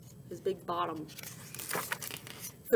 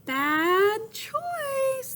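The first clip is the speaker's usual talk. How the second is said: calling out; 13 semitones higher, 4 dB louder than usual. Acted. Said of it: "they called".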